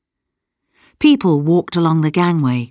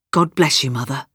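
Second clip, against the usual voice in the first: tender farewell; 3 semitones lower than usual. A narrator's reading. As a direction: give a subdued, loving goodbye. Father's tender farewell to his mother.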